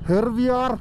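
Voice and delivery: high, drawn-out vlogger hype; excited announcement. Real, not acted. Vlogger announcing the ride to Khardungla, excited announcement. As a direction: stretch it out high for the viewers. Announcing departure to vlog viewers.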